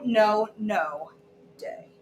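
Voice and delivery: ordinary delivery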